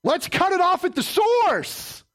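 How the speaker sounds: high, slow, shouted declaration; preaching shout. Acted. Preacher to congregation, shouting the climax. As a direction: shout it out at the source.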